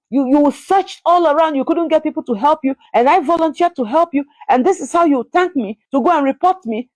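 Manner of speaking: high-pitched retold scolding; angry tirade. Lady blasting the sick mother for reporting her, angry high-pitched tirade. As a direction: blast them with rapid-fire accusations, voice pitched well up. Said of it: "She blasted me, saying all kinds of things".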